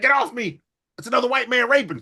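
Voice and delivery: high-pitched mock alarm; hammed-up, high cry of objection; acted-out protest. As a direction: protest like you're being grabbed, voice jumping up. Acts out wrestler protesting in brawl.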